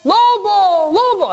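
very high mock scream for help; grito de alarma. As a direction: cry out as the boy facing the wolf. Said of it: "Y gritó". (grito agudo de auxilio)

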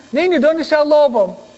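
high-pitched urgent villagers; hombres preguntando al niño. Retold or imitated. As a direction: call to the boy for an answer. Men question the boy. (aldeanos llamando al niño, voz aguda)

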